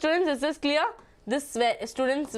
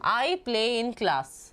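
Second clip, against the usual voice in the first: slow dictation; much slower than usual. Shown as a demonstration. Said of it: "You're gonna learn it this way".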